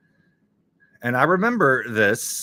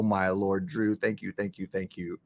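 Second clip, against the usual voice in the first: ordinary talk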